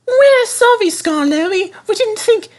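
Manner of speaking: very high, shrill-free squeaking sorry coaches; squeaky coaches. A narrator's reading. Naughty coaches apologising to Skarloey, whining. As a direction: whine out your excuses.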